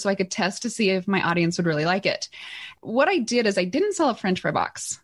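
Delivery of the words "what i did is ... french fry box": ordinary talk, nothing marked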